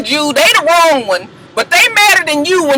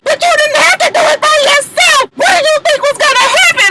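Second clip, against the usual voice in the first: very high, loud mock shout; hammed-up high yelling; shouting castmate; 3 dB louder, faster than usual. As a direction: yell it high in her face. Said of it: "she was just like".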